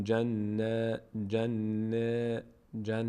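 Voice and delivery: جن with held n, then a thin front æ; hummed n and light unrounded vowel in جن. Shown as a demonstration. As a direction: mouth spread sideways after the doubled n of جن.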